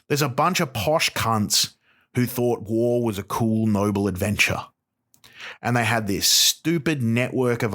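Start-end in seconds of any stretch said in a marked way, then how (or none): none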